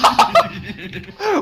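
high, loud cackling burst; startled laugh. Real, not acted. Startled laughter after being hacked.

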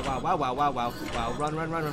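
usual speaking voice

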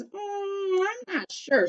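high, doubtful hum; suspicious. Shown as a demonstration. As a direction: sound unsure and wary. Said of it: "Suspicious means".

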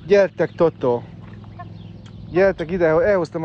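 loud, high animal call; loud sing-out greeting to pets. Real, not acted. Calling out to animals.

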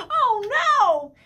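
very high, loud gasping "Oh no"; shocked cry. Acted. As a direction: shriek it in mock horror. Fish eaten in song.

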